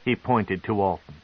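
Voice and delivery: usual speaking voice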